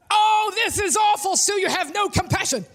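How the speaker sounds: high, scolding outcry; mock righteous outrage, pitched high; indignant church woman. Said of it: "The woman goes".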